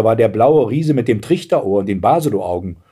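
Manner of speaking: plain reading voice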